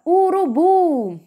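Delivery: "urubu" with a long-held final BU; lengthened final syllable. Shown as a demonstration. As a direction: linger on the last syllable of "urubu". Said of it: "Viram que eu demorei mais no u". ("urubu" demorando no BU final)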